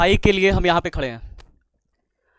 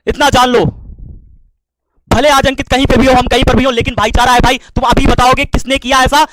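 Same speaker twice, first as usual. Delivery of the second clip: loud, high, fervent outburst; heated, forceful cry of brotherhood; impassioned shouting; 3 semitones higher, 6 dB louder than usual. Real, not acted. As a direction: declare loyalty at full voice. Impassioned outburst defending his brother.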